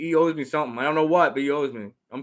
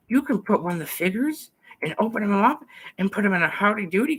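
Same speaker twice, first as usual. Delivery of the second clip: put-on sidekick voice; 6 semitones higher than usual. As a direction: keep the high character voice, leaning in to confide. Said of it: "See if Nick Rack has anything to say".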